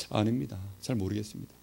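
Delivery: low, subdued retold reply; meek answer. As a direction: answer the elders softly and sheepishly. Meek answer to pressing elders.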